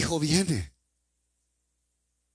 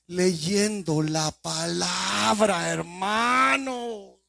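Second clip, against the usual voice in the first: slow drawn emphasis; 4 semitones higher, much slower than usual. Real, not acted. Preacher slowly answering his own question for the brethren, drawn out. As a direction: stretch it out slowly, lingering on hermano.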